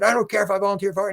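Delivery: quick, high-pitched mock protest; defiant retort. Man fighting with the beings, mock defiant. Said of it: "he's fighting with these".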